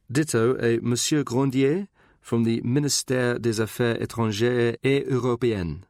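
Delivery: put-on French names in a polished French accent; showy French accent. Secretary showing off her French to her boss, French accent. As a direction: show off your French accent on the ministry name. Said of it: "show off her French accent".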